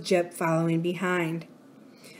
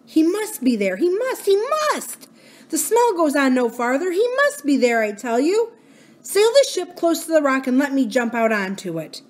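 higher, pressing dog's voice; urgent insisting dog; 7 semitones higher, 3 dB louder than usual. Acted. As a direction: insist he must be there.

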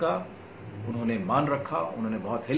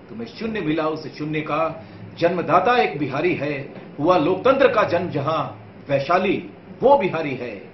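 louder, higher, driving verse; rousing poem recitation; 3 semitones higher, 5 dB louder than usual. Acted. Poet repeats Bihar's glories to viewers, rousing declamation. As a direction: declaim it proudly in a connected, forceful run.

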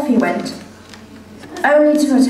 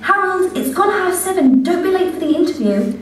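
higher, sing-song mother calling her son; doting mother voice; 9 semitones higher than usual. Acted. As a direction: coo at your grown son like a fond mother. Mother voice in told story.

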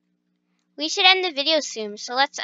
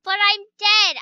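mock dramatic; 6 semitones higher, 7 dB louder than usual. Playing dead after screaming.

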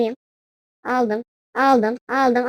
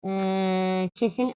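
normal talking voice

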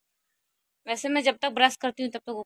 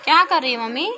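calling out; 5 semitones higher, 7 dB louder than usual. Real, not acted. Calling out to mother.

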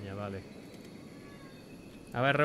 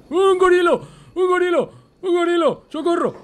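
very high, very loud shrieks of alarm; panicked screaming; 12 dB louder than usual. Real, not acted. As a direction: scream for help as a beast attacks. Panics at gorilla, calls for help.

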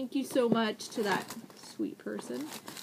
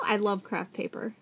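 ordinary talk

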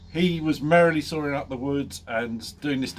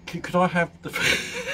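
laughing. Real, not acted. Laughing breaks the quote.

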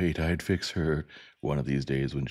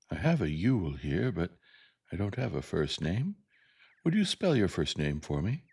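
careful schoolmistress voice; Miss Caroline, polite teacher; 2 semitones higher than usual. Acted. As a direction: ask the pupil politely, with rising pitch. Miss Caroline questions Burris.

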